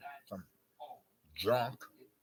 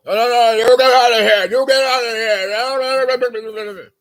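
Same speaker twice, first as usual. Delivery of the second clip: much higher, much louder yell; retold yelling; 13 semitones higher, 21 dB louder than usual. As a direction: throw him out at full volume. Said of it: "mouthing off".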